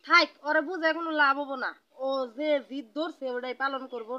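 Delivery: loud, exasperated outburst; exasperated giving up. Acted. Mother gives up on stubborn son. (বিরক্ত হয়ে চড়া গলায় হাল ছেড়ে দেওয়া)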